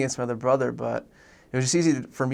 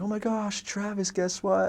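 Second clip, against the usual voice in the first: high-pitched imitation of an excited mom; mom freaking out; 8 semitones higher, slower than usual. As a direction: gush it high like a thrilled mom, without shouting. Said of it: "she was, like, freaking out".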